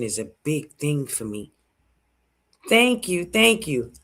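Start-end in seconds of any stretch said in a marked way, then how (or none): 2.7-3.9 excited thanks. Real, not acted. Reacting to live comments.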